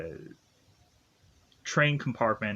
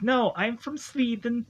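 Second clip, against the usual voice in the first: high put-on Swedish girl voice, pitched way up; fake Swedish girl; 11 semitones higher than usual. Ophelia insisting to Coleman she's Swedish, high put-on girl voice. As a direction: play up the fake Swede insisting on her origin. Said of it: "And she's like".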